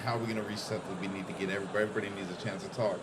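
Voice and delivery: hushed, low mock panic among delegates; panicky delegates. Streamer mimics panicking delegates, hushed and low. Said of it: "They're panicking, like".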